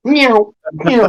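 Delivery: a quick playful meow; imitated meow. Imitated noise in story.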